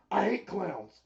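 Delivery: high, loud hammed-up growl of disgust; mock shout. Retelling Jericho's promo to his cohost, loud mock shout. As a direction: shout it like a wrestler who hates clowns.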